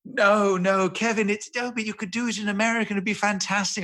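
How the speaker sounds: high, coaxing producer voice; BBC producer. Retold or imitated. Producer persuading the star to play it American, coaxing. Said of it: "And he's like".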